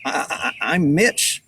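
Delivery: put-on mildly nervous boy, stuttering. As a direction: stumble nervously over the first word. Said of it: "he stuttered nervously".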